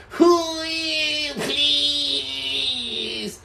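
high, long-drawn whiny wail; hammed-up crying, stretched out thin and high; mock wailing. Imitating a TikToker out of content, mock wailing. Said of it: "just sitting there like this".

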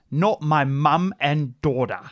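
lightly put-on British accent, drawn out. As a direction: read it slowly with a British lilt. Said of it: "They said it in that accent".

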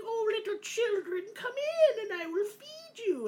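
very high, sweet crone voice; sugary old witch. Acted. Witch luring the children inside, high and sickly sweet. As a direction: coo it like a sly grandma.

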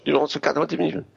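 usual speaking voice

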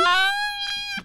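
real high-pitched burst of laughter. Real, not acted. Host cracking up at the cactus quote, high laugh.

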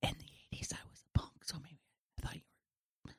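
soft whispered explanation. Acted. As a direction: whisper back to explain yourself. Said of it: "We are all whispering".

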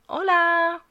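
put-on Spanish accent. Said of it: "People do it to me all the time with Spanish".

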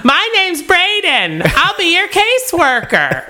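put-on high, slow little-kid voice; child voice. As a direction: play a tiny kid caseworker. Child caseworker voice, joking.

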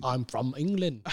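soft mock Cockney; quiet Cockney impression; Michael Caine. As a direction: lightly do a Cockney butler. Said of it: "I've got a Cockney accent".

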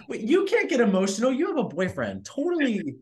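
high, bright mock scolding; playful teasing. Real, not acted. Teasing friend about her boyfriend.

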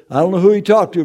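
normal talking voice

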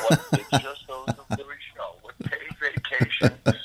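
whispered through laughter; laughing whisper. Real, not acted. Joking about payola, laughing under breath. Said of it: "mock confession laughing".

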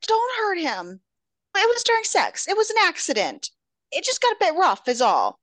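high, hurried defensive plea; acted anxious, high-pitched protest; pleading. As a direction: rush the excuse out in a high voice. Heroine pleads for her boyfriend.

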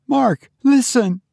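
high, urgent wife voice; fierce urgency. A narrator's reading. Wife making husband listen, urgently. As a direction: urge him in a high voice. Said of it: "she whispered with fierce urgency".

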